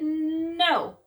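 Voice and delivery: high, loud no; flat refusal. A narrator's reading. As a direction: bark it back. Father argues.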